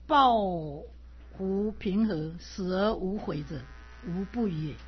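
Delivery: slow, measured reading of the Analects; deliberate classroom reading of classical Chinese; classical text recitation. Acted. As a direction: recite each phrase slowly like a teacher reading scripture. Reads Analects passage aloud.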